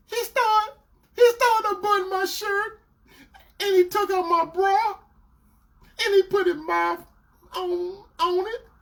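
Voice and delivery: high, whimpering mimicry; tearful girlfriend. Girlfriend confessing each step, imitated tearfully. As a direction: confess haltingly in a high mock cry.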